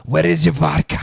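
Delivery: loud put-on Russian accent. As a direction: boom it out in mock Russian. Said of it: "what's your Russian accent".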